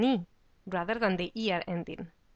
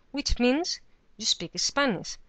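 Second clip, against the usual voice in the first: usual speaking voice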